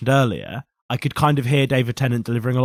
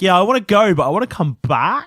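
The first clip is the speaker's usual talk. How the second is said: lightly acted eager reply, higher and louder; mock eager; 7 semitones higher, 5 dB louder than usual. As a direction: play the keen Doctor, pitched up. Acting out the Doctor's eager reply.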